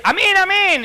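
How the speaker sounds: high, loud, sing-song amen; hammed-up churchwomen chanting amen; mindless amen sisters. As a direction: parrot the amen without listening. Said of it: "grupo de".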